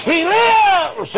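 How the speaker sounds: high, loud, slow ringing proclamation; full-force preaching. Acted. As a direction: shout the victory, voice near tears.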